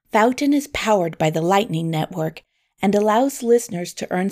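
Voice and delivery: podcast host plugging an app; promotional read. Real, not acted.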